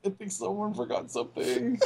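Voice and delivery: amused, laughing while speaking. Real, not acted. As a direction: laugh through the words. Laughing at the retold gag.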